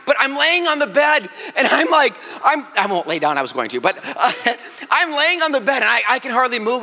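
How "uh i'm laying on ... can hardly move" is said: normal talking voice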